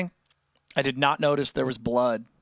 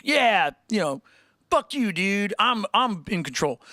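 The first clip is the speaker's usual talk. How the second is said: a slightly higher, cocky mock punk; punk rock defiance; 6 semitones higher, slower than usual. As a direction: brush everyone off like a rebel in charge. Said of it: "somebody being punk rock".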